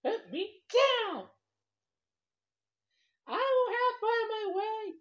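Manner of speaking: high fish voice demanding, slow on the second put me down; an outraged little fish ordering to be put down; angry fish. Acted. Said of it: "he said".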